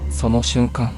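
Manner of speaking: plain reading voice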